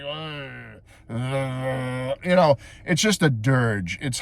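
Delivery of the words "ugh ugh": low, dragged-out groan of misery; droning groan. Retold or imitated. Reviewer imitates the horrible dirge, low droning groan. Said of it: "It's like".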